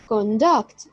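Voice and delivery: "conduct" said as con-DUCT, second syllable strong; stress on second syllable. Shown as a demonstration. Teacher repeating the verb conduct, stressed on the second syllable. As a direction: hold back the start of "conduct", land on DUCT. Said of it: "with a stress on the second syllable".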